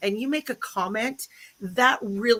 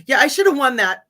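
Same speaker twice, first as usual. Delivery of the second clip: mock boast; 8 dB louder than usual. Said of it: "No, I'm just kidding".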